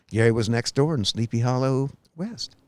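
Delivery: ordinary talk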